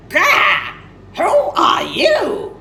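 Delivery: high, loud, alarmed squawk; squawking peacock. Acted. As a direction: demand it like a startled bird. Peacocks confront the strange creature.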